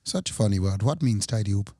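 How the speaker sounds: bad Swedish accent, pitched low; a clumsy Swedish accent, dropped low. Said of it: "It was supposed to be a Swedish accent".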